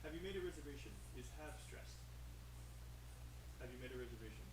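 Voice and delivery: very soft, away from the mic; hushed off-mic question; quiet aside. Real, not acted. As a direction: check it under your breath with someone nearby. Checking privately with an expert off-mic.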